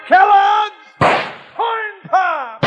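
big booming sponsor callout; announcer shout. Acted. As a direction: shout the brand name like a radio ad. Sponsor tag shouted over music.

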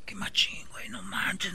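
whispered amazement; awed whisper. Acted. Boy secretly watching in the story, awed whisper. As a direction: whisper it under your breath.

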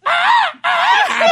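very high, loud "ah" squeal; high squealed shout. Retold or imitated. Band's shouter answering the doorman, high squeal. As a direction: answer with a hype man's squeal. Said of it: "¿Usted qué toca?".

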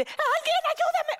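mock-panicked call for help, high-pitched; panicked cry for help. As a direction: shriek for someone to save you from the scorpion. Acting out panic at scorpions.

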